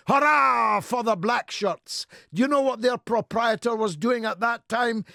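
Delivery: high, shouted political denunciation; fiery oratory. Real, not acted. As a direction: thunder it out to a rally crowd. Impassioned political oratory at full force.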